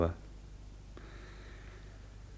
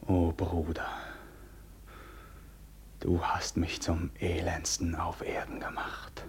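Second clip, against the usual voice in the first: softer, whispered despair; anguished whisper; 2 semitones lower, 8 dB quieter than usual. Acted. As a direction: break under the betrayal. Karl klagt den Bruder an. (verzweifelt geflüstert)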